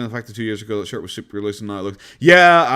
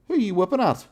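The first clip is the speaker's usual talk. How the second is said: higher pet voice, teasing the dog; sing-song at a playful husky; talking to the dog; 10 semitones higher than usual. Real, not acted. Streamer asks her whining husky in a higher pet voice.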